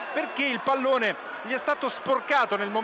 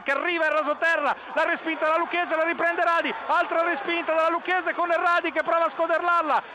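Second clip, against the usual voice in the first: excited play-by-play; 8 semitones higher than usual. Acted. Live play-by-play building.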